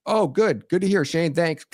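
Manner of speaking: high, chirpy and careless sign-off; imitating a cheerful official who doesn't care; mock breezy caseworker. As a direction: sound satisfied and ready to hang up. Caseworker ends wellness check call.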